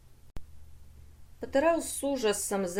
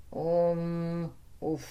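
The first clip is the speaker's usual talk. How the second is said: groan; 2 semitones lower, 3 dB quieter than usual. A narrator's reading. The battered brain revives, groaning. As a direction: groan weakly on coming round.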